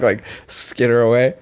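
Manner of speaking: ordinary talk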